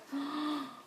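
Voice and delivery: short high gasp; quick intake of wonder; delighted gasp. Real, not acted. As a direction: gasp in happy surprise. Delighted by the gift.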